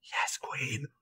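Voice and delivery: whispered, soft and submissive. A narrator's reading. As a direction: breathe the words out. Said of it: "Becky softly says back".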